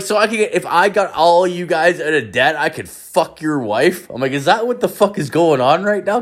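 high-pitched indignant disbelief; incredulous questioning. Retold or imitated. Confronting friends over paying their debts, incredulous. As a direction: retell it as a rising, outraged question.

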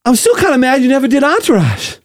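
high, winded actor impression; Mark Wahlberg. Actor grumbling to Shaq, breathless Wahlberg voice. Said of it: "You're gonna go Wahlberg".

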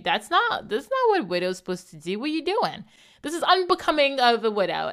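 imitating a high, scolding brother; mock his prim disapproval; disapproving brother. Brother scolding the widow, mock prim tone. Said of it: "He only has that experience to bring back to my behavior and say".

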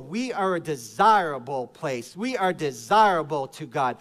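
normal talking voice

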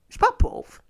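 very high, loud put-on protest; mock high denial. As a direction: squeal the denial in a cartoon voice. Mock denial of being poor.

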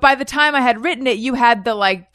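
ordinary talk, nothing marked

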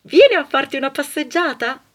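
chirpy friend calling with an invite; cheery friend on phone. Acted. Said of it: "Squilla il telefono". (amica al telefono, squillante e molto più acuta)

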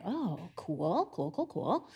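a hint of uneasy going-along; nervous cool cool. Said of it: "they were like".

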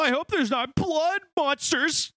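high, slow mock-worried voice; mock scared YouTuber. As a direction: ham up a nervous streamer. Mocking YouTuber.

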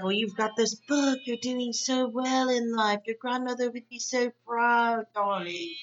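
lightly imitated grande dame. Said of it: "L V P's building up".

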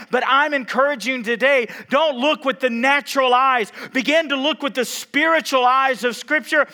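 loud, high-pitched exhortation; full-force preaching. Real, not acted. Preacher encouraging the church to look with spiritual eyes, full-force preaching. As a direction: urge them on at full voice.

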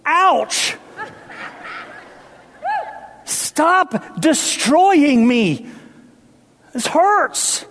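high, slow pained cry of the house; a wounded house begging, high and drawn out. Acted. The preacher voicing the old house in pain. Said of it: "They'd say".

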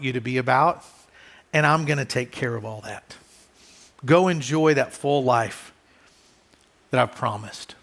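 tender fatherly voice; reassuring God. Pretend. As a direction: comfort them calmly and low, like a father. God voiced reassuring his children.

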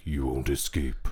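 low, ominous trailer narrator; deep voiceover for an eighties movie trailer; horror trailer voice. Retold or imitated. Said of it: "movie trailer voiceover".

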